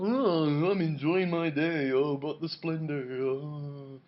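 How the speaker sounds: slow, swooning gothic lament. Retold or imitated. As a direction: drawl it out in mock-gothic rapture. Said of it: "it's all very gothic".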